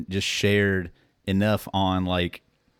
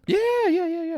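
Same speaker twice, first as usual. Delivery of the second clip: excited high yeah. Real, not acted. Eagerly accepting a drink.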